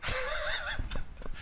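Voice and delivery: high, soft laugh. Real, not acted. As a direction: laugh lightly at your own rant. Laughs after his rant.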